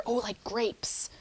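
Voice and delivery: slow whispered aside. Real, not acted. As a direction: whisper it slowly. Quiet aside.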